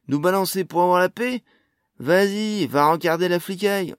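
loud, high, goading dare; defiant challenge. A narrator's reading. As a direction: throw it in their face. Jasion dares dwarf to inform police.

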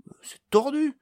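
high-pitched doubtful reply; unconvinced. A narrator's reading. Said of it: "peu convaincu".

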